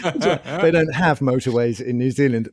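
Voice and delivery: laughing. Retold or imitated. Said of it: "She's like".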